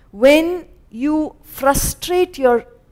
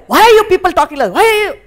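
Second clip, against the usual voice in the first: fast, higher, louder scold; irritated scolding; 7 semitones higher, 5 dB louder, much faster than usual. Retold or imitated. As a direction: snap at the noisy family. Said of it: "First thing she'll get anger".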